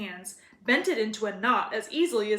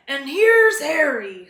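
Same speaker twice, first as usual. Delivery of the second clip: louder, slow and pleased; warm giant greeting; 5 semitones higher, 11 dB louder, slower than usual. A narrator's reading. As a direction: greet the boy like a big friendly giant. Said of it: "said the giant".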